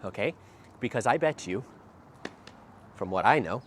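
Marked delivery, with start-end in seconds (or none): none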